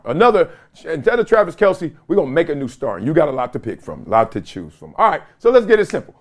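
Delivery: ordinary delivery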